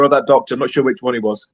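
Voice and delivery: ordinary talk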